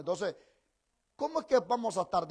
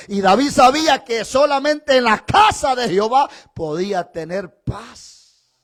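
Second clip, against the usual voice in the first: louder and louder, full-force sermon climax; fiery preaching; 2 semitones lower, 12 dB louder than usual. Acted. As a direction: build to a roaring climax about David's love.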